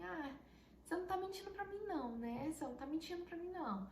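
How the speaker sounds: high, fast, disbelieving voice for the husband; incredulous husband. Retold or imitated. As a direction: rush it out high, like he can't believe it. Husband doubting the pregnancy news. (marido incrédulo, voz aguda e rápida)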